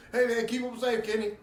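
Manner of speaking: loud, high plea over the shoulder; gleeful high holler; laughing shout. Acted. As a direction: yell back while laughing. Shouting back while fleeing, laughing.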